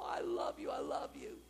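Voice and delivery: soft, breathy whispered I love you; tender relief. Pretend. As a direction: hold the child close and murmur it. Mother hugging found child.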